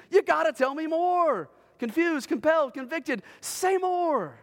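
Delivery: high, insistent bewildered questioning; confused and compelled. Acted. Jailer questions the praising prisoners, confused and compelled. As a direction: demand answers, astonished and pressing. The jailer baffled, demanding answers.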